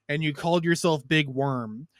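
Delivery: usual speaking voice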